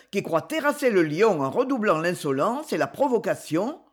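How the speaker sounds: usual speaking voice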